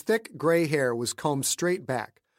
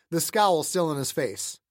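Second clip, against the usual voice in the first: plain reading voice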